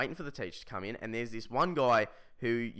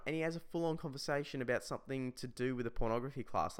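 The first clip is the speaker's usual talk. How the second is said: usual speaking voice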